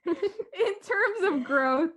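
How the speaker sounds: high, slow, through giggles; helplessly amused, pitch way up; laughing. Real, not acted. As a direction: struggle to get back on topic while laughing. Laughing after fumbled acronyms.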